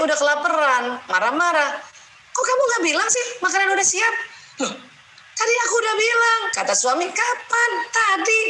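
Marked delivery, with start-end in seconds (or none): 2.3-4.1 much higher, indignant mock complaint; complaining husband. Acted quarrel, hungry husband complains. 4.7-6.4 high, fast, indignant mock protest; defensive wife. Acted quarrel, wife replies. 7.1-7.7 sharp high mock disbelief; incredulous husband. Acted quarrel, husband asks. 7.9-8.4 high, rising, exasperated insistence; insisting wife. Acted. Acted quarrel, wife insists.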